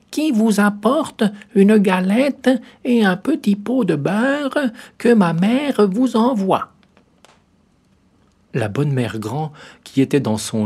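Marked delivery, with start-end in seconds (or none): 0.1-6.7 high, slow, loud put-on girlish voice; the wolf mimicking the little girl; wolf faking a girl's voice. A narrator's reading. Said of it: "en contrefaisant sa voix".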